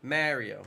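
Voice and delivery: "Mario" with the A of "marry"; mispronounced Mario. Shown as a demonstration. Streamer imitating how they pronounce it, "Mario" as MARE-ee-oh. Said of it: "They pronounce the A".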